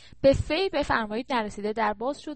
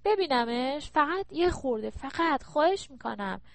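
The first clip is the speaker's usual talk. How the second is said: pleading in short, higher-pitched bursts; wheedling plea; 4 semitones higher than usual. Acted. Daughter begs mother for a look at the phone, wheedling plea. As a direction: beg like a coaxing child.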